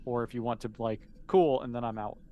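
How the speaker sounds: normal talking voice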